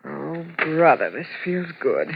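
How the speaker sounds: slow, low and soft; sinking into bed; relieved sigh. Acted. Lying on bed exhausted.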